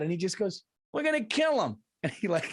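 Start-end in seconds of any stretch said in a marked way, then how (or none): 0.9-1.8 high-pitched movie-character exterminator; old-school exterminator. Retold or imitated. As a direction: play a hammed-up old pest-control man. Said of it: "he looked like a movie character".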